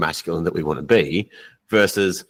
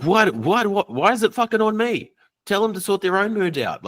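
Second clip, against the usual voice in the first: much higher, whiny mock outrage; mock angry client; 12 semitones higher than usual. Coach imitating a pissed-off client, high mock outrage. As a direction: play the outraged client protesting. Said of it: "pissed off".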